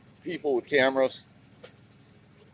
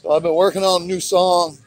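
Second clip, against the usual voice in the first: louder, higher, eager; excited announcing; 5 semitones higher, 6 dB louder than usual. Real, not acted. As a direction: announce it with gusto. Announces song, raised voice.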